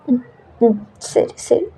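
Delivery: a quiet shushing whisper; soft hushing whisper. Acted. Jimin hushing crying Jungkook in a soft whisper. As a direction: hush him gently, barely voiced.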